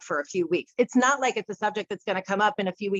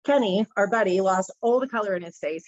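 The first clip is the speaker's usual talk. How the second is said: usual speaking voice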